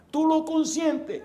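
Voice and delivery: high, drawn-out mock scolding; scolding mother. Mother scolding over a spoiled child, high mock indignation.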